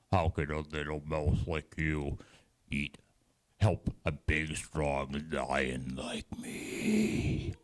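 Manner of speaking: a big lion belittling the mouse, trailing off breathily; scoffing lion. Acted. Lion mocks little mouse.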